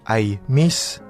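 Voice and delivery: ordinary delivery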